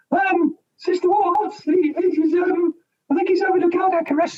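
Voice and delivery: a terrified trainee's high squeak; high-pitched panicky voice. Retold or imitated. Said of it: "Very high-pitched, panicky voice".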